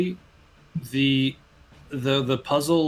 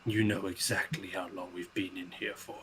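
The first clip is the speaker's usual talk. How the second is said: low, hushed accusation; soft, lowered voice of a suspicious paladin; distrustful paladin; 4 semitones lower, 10 dB quieter than usual. Acted. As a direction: answer quietly and low, trusting nobody. Wary paladin answers accusingly in character.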